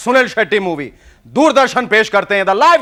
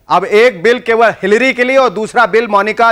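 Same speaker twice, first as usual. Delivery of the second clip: usual speaking voice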